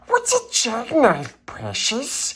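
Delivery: high, puzzled creature voice; Gollum question. Acted. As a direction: ask it like a baffled Gollum. Gollum aside questioning the text.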